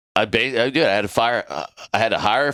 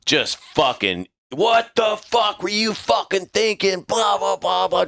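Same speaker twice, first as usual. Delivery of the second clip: high, slow furious rant; angry chewing out; 12 semitones higher, slower than usual. Retold or imitated. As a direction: tear into the junior sailor. Master Chief unloading on him.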